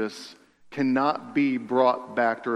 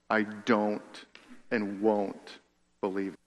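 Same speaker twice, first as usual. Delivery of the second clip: soft and low, each word set apart by pauses; a hushed, final inner vow; quiet, deliberate refusal; 5 semitones lower, 8 dB quieter, slower than usual. Retold or imitated. Heart decides to reject belief.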